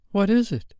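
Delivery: high-pitched, worried question; anxious. A narrator's reading. Wendy worried about Peter at once, anxious. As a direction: ask with sudden concern, pitched high. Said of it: "anxious about him at once".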